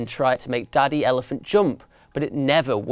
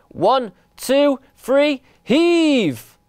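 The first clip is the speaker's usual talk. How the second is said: high calls building to a drawn-out heave; straining count-in; 11 semitones higher, 4 dB louder, much slower than usual. A narrator's reading. Mice counting to lift the sofa, straining count-in. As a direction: count up and haul on the last word.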